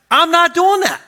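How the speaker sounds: hammed-up stubborn no; defiant refusal. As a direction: dig in your heels, put out. Husband refuses the imagined commitment.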